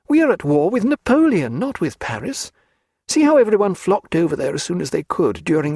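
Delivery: a high, quick, eager lady's voice; Diana, coaxing. A narrator's reading. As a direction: talk him round, pitched high and bright with hope. Diana argues for Paris.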